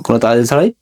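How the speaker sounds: ordinary talk, nothing marked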